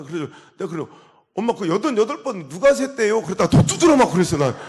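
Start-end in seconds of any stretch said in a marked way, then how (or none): 1.3-3.2 sing-song, louder cheeky retort; cheeky question. Retold or imitated. Son teasing his mother about the eighty-eight, sing-song and louder. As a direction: tease her with a lilting question.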